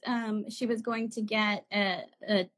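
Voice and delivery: normal talking voice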